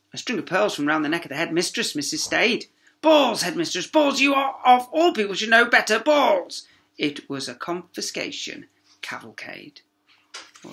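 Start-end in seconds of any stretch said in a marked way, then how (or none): 3.0-6.7 loud, higher indignant rant; outraged scolding. Acted. Teacher scolds the headmistress over her pearls, loud. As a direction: tell off the headmistress.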